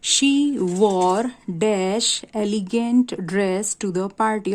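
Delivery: very slow reading of a gap-fill sentence; slow teacher reading of a blank sentence; slow exercise reading. Shown as a demonstration. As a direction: read the exercise aloud slowly, word by word. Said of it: "fill in, fill ups को।".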